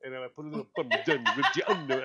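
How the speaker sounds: a hint of a showy Italian accent; mock Giada Italian. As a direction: imitate a TV chef rolling Italian words. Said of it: "she go".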